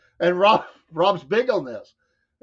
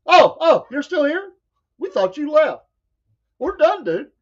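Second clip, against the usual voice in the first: mock-surprised, pitched well up and a little louder; surprised high; 9 semitones higher, 4 dB louder than usual. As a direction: hosts amazed someone's still on the call, surprised. Hosts surprised guest still there.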